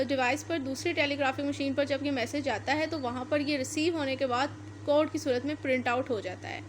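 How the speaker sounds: ordinary talk, nothing marked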